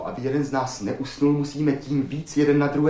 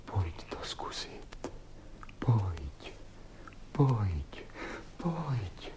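low, whispery invitation; hushed coaxing; 6 semitones lower, 13 dB quieter, much slower than usual. Acted. Man inviting his partner to try it, hushed coaxing. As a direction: coax very softly, drawing the words out.

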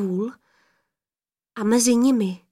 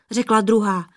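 ordinary delivery, nothing marked

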